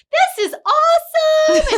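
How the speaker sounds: high, loud, slow gushing; imitating an excited fan hugging. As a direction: squeal it like a thrilled fan. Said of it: "And she's just".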